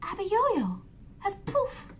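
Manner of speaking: amazed. A narrator's reading.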